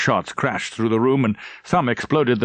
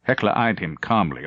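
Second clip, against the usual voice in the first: usual speaking voice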